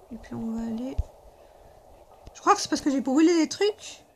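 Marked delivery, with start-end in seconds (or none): 0.1-1.0 whispering while hiding. Real, not acted. Hushed while sneaking.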